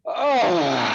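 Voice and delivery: long, loose sighing "ah"; exhale demo. Shown as a demonstration. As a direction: let a big breath out on an "ah". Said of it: "as you exhale, just".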